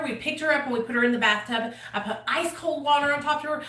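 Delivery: ordinary talk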